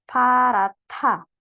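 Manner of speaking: "parata" stretched slowly, T clear; slow model reading. Shown as a demonstration. Teacher slowly modelling the word for the student. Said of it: "Hiet ketemu diget".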